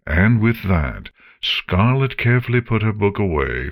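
plain reading voice

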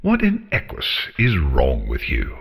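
haughty dragon at the end of her patience; theatrical exasperated scolding. A narrator's reading. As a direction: burst out high in exasperation, then drop. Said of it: "Scarlet made an exasperated noise".

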